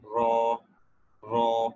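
रॉ drilled with retroflex r; pinyin r sound. Shown as a demonstration. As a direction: repeat रॉ for students. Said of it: "आर जो है वो sound देगा रॉ". (आर की ध्वनि रॉ)

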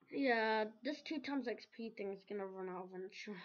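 ordinary talk

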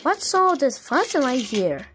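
normal talking voice

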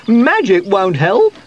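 high, loud squeaky voice; scornful squeak. A narrator's reading. Sweep doubts that magic will work, squeaking. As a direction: squeak your doubt loudly. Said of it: "squeaked Sweep".

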